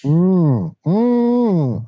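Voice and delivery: muffled through a throat mic. Real, not acted. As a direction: hum into a contact mic at the throat. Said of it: "deep throat microphone going".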